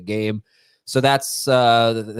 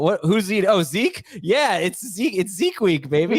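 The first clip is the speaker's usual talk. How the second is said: higher-pitched playful hype; excited hype; 8 semitones higher than usual. Real, not acted. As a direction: celebrate it like big news. Celebrating Zeke's week on the show.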